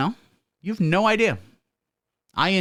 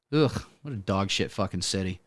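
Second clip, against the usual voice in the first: disgusted; 6 semitones lower, 6 dB quieter than usual. Acted. Disgusted aside about Chicago.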